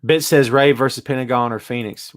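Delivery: ordinary delivery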